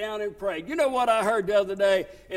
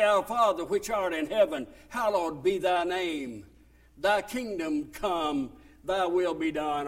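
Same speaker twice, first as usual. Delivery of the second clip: measured Lord's Prayer cadence; prayer recitation; 4 semitones lower than usual. Acted.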